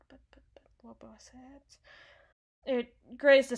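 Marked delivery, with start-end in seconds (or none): 0.1-1.8 very soft, near-whispered muttering; muttering to self. Acted. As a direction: murmur while searching your memory. Trying to recall a detail.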